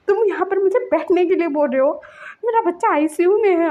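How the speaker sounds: retold crying parent; tearful anger. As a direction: protest through tears. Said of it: "बोल के वो रो रहा था".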